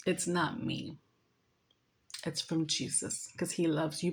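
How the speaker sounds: soft, low retold reassurance; hushed, low sincerity; gentle. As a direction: break it to him softly. Retells gentle words to the man.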